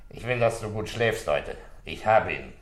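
ordinary delivery

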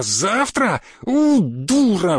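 drawn-out wail of frustration and insult; slow, stretched, outraged moan; exasperated howl. Acted. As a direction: howl at the secretary in disbelief. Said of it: "взвыл человек".

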